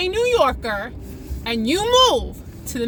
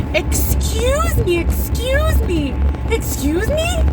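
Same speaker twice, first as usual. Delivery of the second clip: high, slow, singsong excuse-me; mock-polite high-pitched voice; mimicked Midwesterners; 8 semitones higher, slower than usual. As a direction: drag it out high in exaggerated politeness. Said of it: "prepare yourself for everyone saying, "Excuse me".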